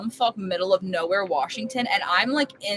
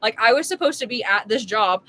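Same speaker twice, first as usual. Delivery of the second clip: normal talking voice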